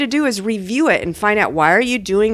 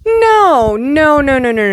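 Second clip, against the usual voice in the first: excited interruption; 6 semitones higher, 4 dB louder, slower than usual. Real, not acted. Interrupting the student.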